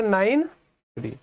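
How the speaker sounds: Nine and three drawn out as separate digits. Shown as a demonstration. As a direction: read "nine three" slowly, one digit at a time. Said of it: "decimal के बाद इसे कैसे पढ़ोगे?".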